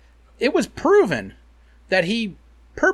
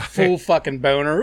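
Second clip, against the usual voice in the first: normal talking voice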